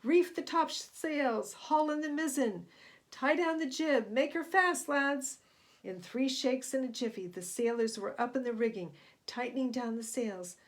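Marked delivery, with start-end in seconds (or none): 0.0-5.4 loud, higher barked captain's orders; roared orders. A narrator's reading. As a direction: roar orders across a storm. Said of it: "he roared".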